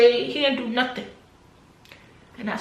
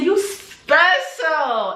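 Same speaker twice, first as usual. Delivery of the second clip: slow, high, drawn-out "You special."; awed slow words; 6 semitones higher, 3 dB louder, much slower than usual. Retold or imitated. Recalling the pastor's moment, slow high awe. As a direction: stretch each word out in awe.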